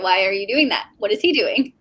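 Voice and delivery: ordinary talk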